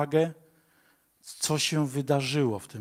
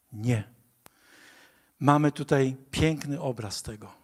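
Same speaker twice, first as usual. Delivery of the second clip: ordinary delivery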